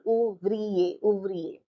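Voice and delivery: ouvrier as ou-vri-er, falling at the end; French ouvrier, syllable by syllable; three syllables. Shown as a demonstration. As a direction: give ouvrier three clear beats. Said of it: "Three syllables".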